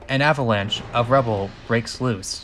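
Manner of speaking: ordinary delivery, nothing marked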